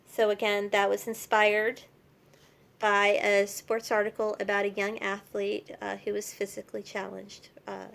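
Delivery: usual speaking voice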